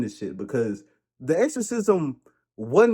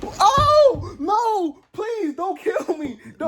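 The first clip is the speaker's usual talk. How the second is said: high panicked pleading; hammed-up scream of fright; terrified; much slower than usual. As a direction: beg for your life, shrieking. Terrified reaction to ghost.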